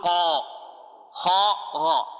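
قا، خا، غا drawn out full and dark; heavy open letters. Shown as a demonstration. Teacher shows how qaf with fatha is pronounced, heavy. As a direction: say قا، خا، غا with a full, rounded heavy vowel. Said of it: "كيف ننطقها؟".

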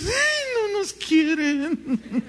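very high, slow mocking whine with a laugh; mock whining. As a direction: parody the sulky church members. Mocking complaining congregants.

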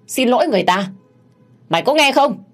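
high, sharp scolding order; angry command. Acted. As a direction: raise pitch and volume into the final demand. Mistress orders maid to apologize. (quát ra lệnh)

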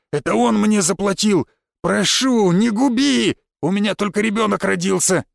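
high-pitched begging; desperate pleading. A narrator's reading. Stranger begs the hero to spare him, pleading. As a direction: beg urgently, pitch climbing well up. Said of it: "Начал умолять меня он".

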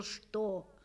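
plain reading voice

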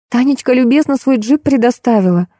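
put-on high, fretful girlish voice; lamenting. A narrator's reading. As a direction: keep up a mild plaintive fuss. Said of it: "продолжала причитать девушка". (причитает высоким девичьим голосом)

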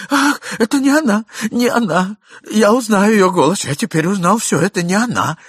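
high, loud, breathless relief; agitated relief. Acted. Husband realizes the voice is not his wife's, excited. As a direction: blurt out relief it isn't her.

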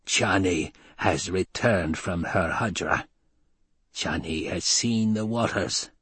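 put-on slow, harsh old woman whisper; harsh amplified whisper. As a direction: rasp it out slowly like an old crone. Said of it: "an amplified whisper, harsh and penetrating".

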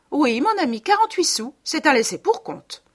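put-on high, loud, sweet young wife; high coaxing wife.

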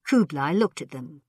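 ordinary delivery

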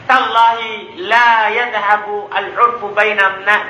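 normal talking voice

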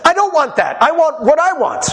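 stubborn side. Retold or imitated. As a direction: dig in like a stubborn kid. Quarrelling side voiced.

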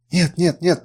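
narrated pleading denial, fully voiced; murmured protest. A narrator's reading. Captive woman refusing her fate, mildly distressed protest. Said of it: "пробормотала она".

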